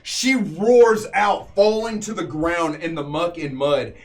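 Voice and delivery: dramatic battle narration, leaning on the big action words; game master narration. A narrator's reading. Game master telling players of the beast's fall, dramatic narration. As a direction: tell the killing blows like an epic tale.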